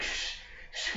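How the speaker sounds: urgent whispered shh; shushing whisper. Retold or imitated. Hushing friends behind the shutter, urgent whisper. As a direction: hush everyone before the landlord hears.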